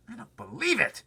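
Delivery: high loud outburst; shouted. Acted. Centipede shouting his disbelief. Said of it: "shouted the centipede".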